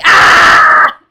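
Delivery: very loud, harsh tic scream; real sudden scream. Real, not acted. Tic interrupting a pretend call, sudden loud scream. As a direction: scream full out mid-introduction. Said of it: "I was going to scream".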